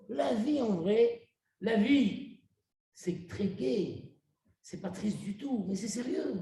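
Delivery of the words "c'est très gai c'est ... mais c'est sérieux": quiet earnest. Real, not acted. Soft earnest aside on life.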